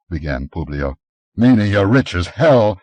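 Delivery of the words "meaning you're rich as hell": louder, mock-contemptuous jab; a touch of scorn, louder; scornful snort. A narrator's reading. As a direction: sneer at his wealth. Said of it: "snorted Conan".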